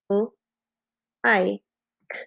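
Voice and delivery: "L-, I-, k" with long gaps between sounds; tapped-out sounds. Shown as a demonstration. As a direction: sound out like phoneme by phoneme. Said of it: "You could tap it out".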